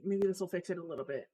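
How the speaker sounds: rapid muttered hope; fast muttering. Real, not acted. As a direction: rush it under the breath. Muttering while fixing settings.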